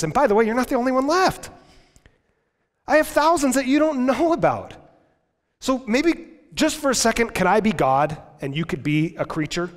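high, loud mock voice; sarcastic God. God retorting to Elijah, high sarcastic voice. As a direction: say it with a sarcastic bite.

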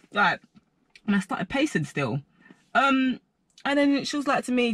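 ordinary talk, nothing marked

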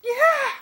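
loud, very high-pitched scream; sudden high scream; blood-curdling shriek. A narrator's reading. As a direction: let out a piercing shriek of terror. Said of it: "I heard a blood-curdling scream".

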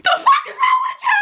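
high-pitched mock outburst at herself; mock scolding. Scolding herself as she sits up crying, high mock yell. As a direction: yell it high, in exasperated disbelief.

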